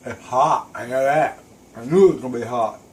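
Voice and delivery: talking through a hot mouthful; mouth full. Real, not acted. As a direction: speak round a bite of food. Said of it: "Talking with my mouth full".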